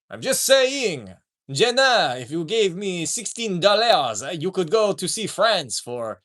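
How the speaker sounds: slow, drawn-out mock French; bad French accent. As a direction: coax like a hammed-up French scammer. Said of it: "I can't do a French accent".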